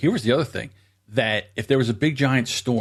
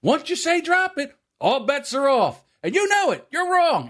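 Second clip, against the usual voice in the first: high, raised accusing voice; heated argument; 13 semitones higher than usual. Retold or imitated. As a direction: argue back hard with dad. Acted quarrel with dad.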